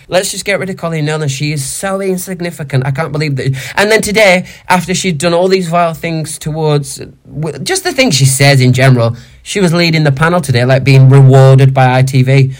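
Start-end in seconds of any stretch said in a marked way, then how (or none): none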